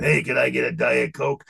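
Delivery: blunt, brash ugly American order. Retold or imitated. Tourist ordering in France, brash ugly American. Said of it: "the ugly American".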